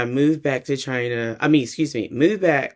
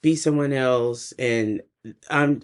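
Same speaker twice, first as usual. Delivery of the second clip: ordinary talk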